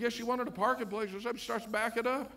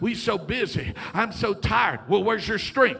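forceful rhythmic sermon delivery; preaching; 11 dB louder than usual. Acted. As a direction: preach it loud to the congregation.

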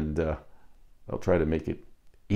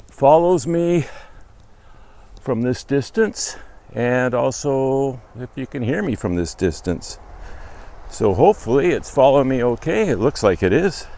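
raised voice calling across a distance; projecting voice; 6 semitones higher, 3 dB louder than usual. Real, not acted. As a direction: project it so the far mic catches you.

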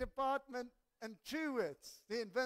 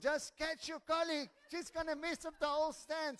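louder, higher urgent call; 7 semitones higher, 5 dB louder than usual. Real, not acted. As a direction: call out to catch her. Calling to staff, lady falling.

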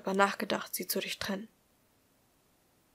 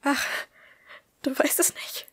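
higher voice, edged with panic; panicky; 6 semitones higher than usual. A narrator's reading. Woman questioning him in rising panic, high and panicky. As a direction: ask it high and a little panicked. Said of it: "Ihre Stimme war höher geworden. Irgendwie panisch".

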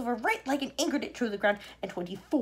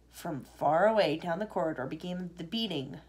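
ordinary delivery, nothing marked

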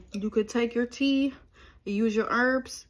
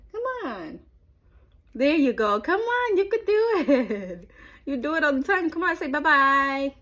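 excited coaxing; 6 semitones higher, 5 dB louder than usual. Real, not acted. Coaxing baby to wave bye.

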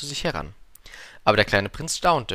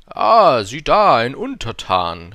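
much louder booming king; königlicher Ausruf; 3 semitones higher, 11 dB louder, slower than usual. A narrator's reading. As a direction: call it out grandly, drawing out the end. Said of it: "rief der König". (lauter, gedehnter Ausruf eines Königs)

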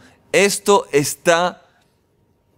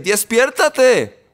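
higher, drawn-out acted call to wake; insistent wake-up call; 5 semitones higher, much slower than usual. Preacher acting out shaking a sleeper, insistent call. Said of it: "a sacudir".

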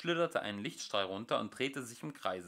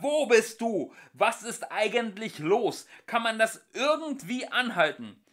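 high, loud quacking penguin; 10 semitones higher, 9 dB louder than usual. Acted. Ford as penguin quacking at the voice. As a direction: quack it out angrily.

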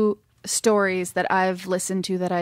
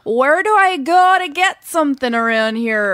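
put-on higher, louder Wisconsin accent; 5 semitones higher, 7 dB louder than usual. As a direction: play a chirpy Wisconsin local. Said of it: "Wisconsin over here".

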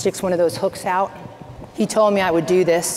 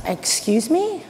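mock-stunned, voice lifting at the end; taken aback; 6 semitones higher, slower than usual. Taken aback by clients' news.

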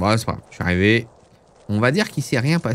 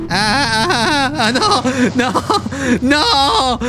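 high anguished wailing cries; raging gamer; 9 semitones higher, slower than usual. Real, not acted. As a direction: wail in despair at the crash. Gamer crashes, cries out in frustration. (cris de rage aigus)

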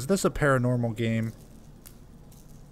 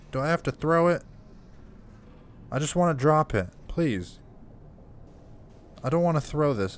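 higher-pitched, reluctant pleading; pleading protest; 6 semitones higher than usual. Real, not acted. Player begging the game not to make him throw a bottle, pleading. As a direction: plead to be let off.

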